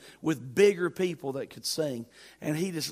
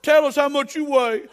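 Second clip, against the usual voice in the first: high, loud, laughing through the words; mock the old director while cracking up; laughing imitation; 10 semitones higher, 10 dB louder than usual. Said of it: "he said".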